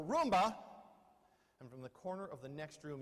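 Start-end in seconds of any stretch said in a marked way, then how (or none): 0.0-0.5 louder yell of the name; raised voice. Retold or imitated. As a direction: call it again, sharper and louder. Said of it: "you raise your voice and go".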